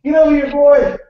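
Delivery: a father summoning his son with a mock yell; mock shout. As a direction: bark for the boy to come over. Calling the kid over, mock father.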